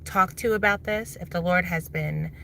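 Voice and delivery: ordinary delivery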